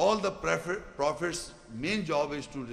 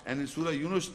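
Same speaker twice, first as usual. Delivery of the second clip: normal talking voice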